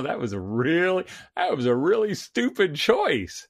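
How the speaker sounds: half-joking parental exclamation; mock parental scolding. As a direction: voice a parent teasing a kid over a blunder. Voices parent reacting to teenager's blunder.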